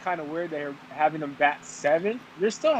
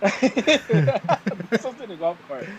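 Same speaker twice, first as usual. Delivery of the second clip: slow, high, laughing through words; 5 semitones higher, much slower than usual. Real, not acted. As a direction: laugh it out slowly. Repeats joke while laughing.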